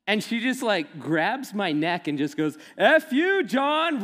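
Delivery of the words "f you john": much higher and louder F you; really loud. Retold or imitated. Jamie yelling her joke at a graduation reception, really loud. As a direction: bellow it while grabbing his neck. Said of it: "really loud".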